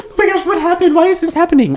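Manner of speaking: very high, squeaky whine; mock panic. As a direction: squeal it like a clueless man panicking. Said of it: "He's like".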